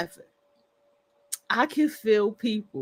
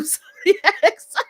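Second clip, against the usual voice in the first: laughing through words; 5 semitones higher, 3 dB louder, slower than usual. Real, not acted. Laughs mid sentence.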